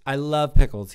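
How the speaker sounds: a near-shouted repeat of the same words; loud insistence. Shown as a demonstration. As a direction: declare it loudly so no one doubts.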